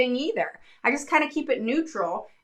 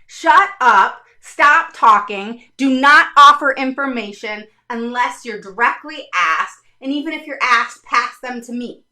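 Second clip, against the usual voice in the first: imitating a loud order; 8 dB louder than usual. Supervisor instructing teacher to stay silent, loud mock order. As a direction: lay down the law like a district boss. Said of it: "because they've told me".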